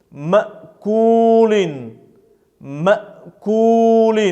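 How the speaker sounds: مأكول with the voice cut off at the hamza; abrupt glottal break. Shown as a demonstration. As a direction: break مأكول after the first syllable, then hold the long vowel. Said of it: "поэтому мы здесь обрываем".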